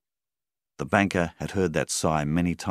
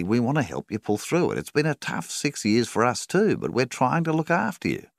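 apologetic, higher voice; 9 semitones higher, 4 dB louder than usual. A narrator's reading. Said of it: "said Rawlings apologetically".

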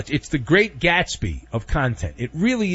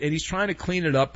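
ordinary talk

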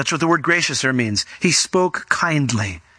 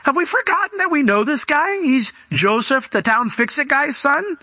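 much higher, gossipy townsman calling out to friends; know-it-all neighbour; 8 semitones higher than usual. Acted. Pastor voices a villager reminding the crowd, high and gossipy. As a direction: play the smug sensible villager. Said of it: "decided they needed to bring some rational thought".

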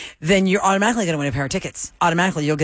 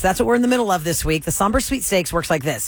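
ordinary talk

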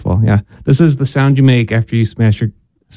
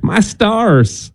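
higher, mock Southern exclamation; southern accent; 8 semitones higher than usual. Host showing the phrase needs a Southern accent. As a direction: gasp it like a Southern lady, in a Southern accent. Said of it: "you would only say that with a southern accent".

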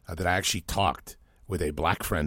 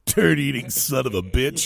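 quoting it through real laughter, higher and louder; laughing; 8 semitones higher, 5 dB louder, slower than usual. Real, not acted. Host repeating the funny ad's insult, laughing. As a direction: crack up while repeating the phrase. Cracking up at the parody ad.

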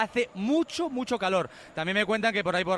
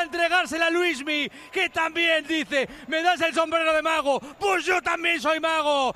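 high, loud, euphoric goal call; breakneck goal commentary; 10 semitones higher, 8 dB louder, slower than usual. Real, not acted. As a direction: shout the goal replay ecstatically. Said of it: "goal celebration commentary".

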